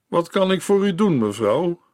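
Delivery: plain reading voice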